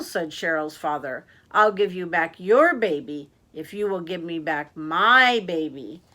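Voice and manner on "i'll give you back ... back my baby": loud bargain, "your" and "my" punched; stress on your, my. Acted. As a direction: lean hard on "your" and "my".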